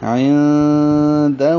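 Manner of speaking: عندہ with the noon hidden in a long nasal hum; nasal ikhfa. Shown as a demonstration. Teacher shows ikhfa before daal, nasal hold. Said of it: "تو یہ بھی اخفا ہے".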